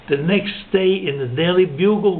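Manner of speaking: ordinary delivery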